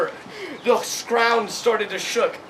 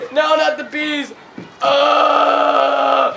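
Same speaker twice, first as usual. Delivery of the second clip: high, slow cry into a loud held scream; hammed-up scream of dread; mock panic; 5 semitones higher, 5 dB louder, much slower than usual. Pupils see the bees pour in, hammed-up panicked scream.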